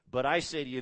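"I" punched loudly in "But I say to you"; emphatic I. Shown as a demonstration. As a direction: lean hard on "I" to show the emphasis. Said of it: "it's emphatic".

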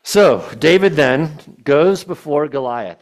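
slow, loud, drawn-out opening; preaching build-up. Real, not acted. Preacher to the congregation, loud and slow.

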